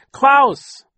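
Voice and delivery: high, loud scream of his name. Acted. Violet screaming for Klaus while being carried off. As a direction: scream for your brother in panic. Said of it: "Violet screamed".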